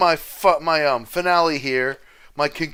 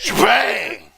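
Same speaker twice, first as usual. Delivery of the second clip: announcer shout; 7 semitones higher, 3 dB louder than usual. Acted. Announcer flourish.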